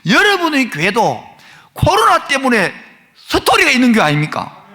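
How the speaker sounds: loud, high, impassioned sermon climax; preaching at full force. Real, not acted. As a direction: drive it home to the congregation, rising.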